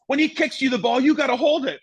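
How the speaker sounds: high, loud mock scolding; hammed-up coach lecture; ranting manager. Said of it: "He goes".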